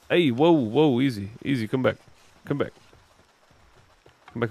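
whoa, easy, come back; calling out. Real, not acted. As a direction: call the pet back urgently. Calling a runaway pal back.

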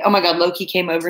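normal talking voice

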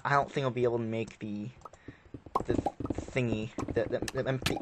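ordinary talk, nothing marked

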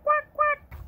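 high, loud duck quacks; happy duck quacking. Acted. Ducky showing children how happy sounds, high quacking. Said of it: "Give me a quack".

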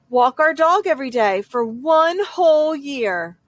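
ordinary delivery, nothing marked

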